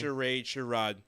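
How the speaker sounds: first "Charade" with 'ayd', second "charade" with 'ahd'; two pronunciations. Shown as a demonstration. Co-host showing either way is fine, contrasting pronunciations. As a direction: contrast "Charade" as sha-RAYD, then sha-RAHD. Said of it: "You could say it however you like".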